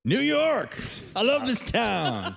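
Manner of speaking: high, slow movie-quote exclamation; Ghostbusters movie quote. Retold or imitated. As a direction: belt it like a film hero. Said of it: "Nice Ghostbusters reference".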